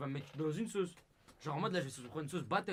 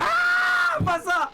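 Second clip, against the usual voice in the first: shrill high yelp of protest; loud protest; 12 dB louder, much slower than usual. Real, not acted. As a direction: cry out no, not that one. Refuse le gage du DM. (cri de refus aigu)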